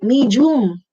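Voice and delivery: "Medium" drawn out as mee-joom; Filipino-style two-syllable "Medium"; J and long E. Shown as a demonstration. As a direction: put a j in the middle of "Medium". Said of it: "with a J".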